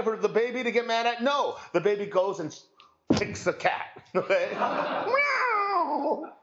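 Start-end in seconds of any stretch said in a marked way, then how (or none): 4.5-6.3 high yowling cat meow. Retold or imitated. Preacher mimics the kicked cat with a high yowling meow.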